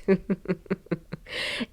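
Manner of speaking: soft, suppressed run of giggles; guilty laughing. Real, not acted. Vlogger giggling at ill-fitted suits, laughing guiltily. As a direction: try and fail to hold the laugh in. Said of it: "I shouldn't laugh".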